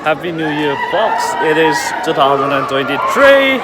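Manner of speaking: shouted greeting. Real, not acted. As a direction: call out good wishes over the celebrations. New Year greeting amid noisy celebrations.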